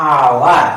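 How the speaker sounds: "আওয়ার" said loud, with the "aa" opening; aa sound stressed. Shown as a demonstration. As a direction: hit the "আ" in "আওয়ার". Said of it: "ওতে আ উচ্চারণ হচ্ছে". ("আওয়ার" জোরে, আ ধ্বনিতে)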